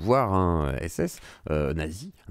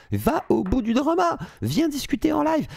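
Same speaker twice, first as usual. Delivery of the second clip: high-pitched, emphatic dare; insistent challenge. Real, not acted. Reactor daring the video maker to debate, raised insistent challenge. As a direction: throw down a challenge, voice raised right up.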